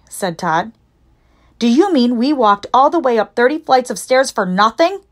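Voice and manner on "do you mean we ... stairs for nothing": higher-pitched, put-out disbelief; exasperated, raised-pitch complaint; indignant robber. A narrator's reading. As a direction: protest like a robber who climbed for nothing. Robber's indignant question in story.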